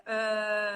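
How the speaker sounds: "uh" as a held neutral vowel; schwa sound. Shown as a demonstration. Guest illustrating the schwa sound, a sustained "uh". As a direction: hold a plain, unrounded "uh". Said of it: "It's a lot of schwa sound".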